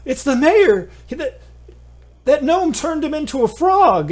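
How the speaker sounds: high, loud shocked shriek; panicked screaming. Acted. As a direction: scream it out in horror. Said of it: "everyone starts gasping and screaming".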